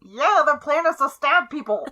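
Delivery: eager little character piping up loudly; Gemini. Acted. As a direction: call it out high and loud. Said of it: "You hear a muffled voice from Kai's side".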